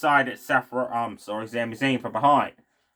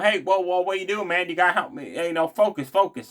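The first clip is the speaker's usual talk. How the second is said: mild mock yell, pitched up; higher-pitched retold yelling, no real volume; Chad Gable yelling; 5 semitones higher than usual. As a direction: imitate a frantic plea without really shouting. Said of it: "Chad Gable's yelling".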